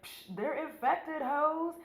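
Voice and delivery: high, drawn-out mock scientist warning; dramatic reenactment. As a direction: overdramatize a dire warning. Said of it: "Dramatic reenactment".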